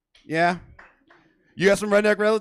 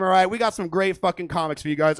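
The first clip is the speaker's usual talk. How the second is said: ordinary talk, nothing marked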